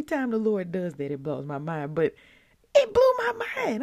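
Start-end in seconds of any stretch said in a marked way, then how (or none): 2.7-3.8 high, loud amazed exclaim; blown away. Real, not acted. Host amazed the verse matches today, loud and high. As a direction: burst out in amazement.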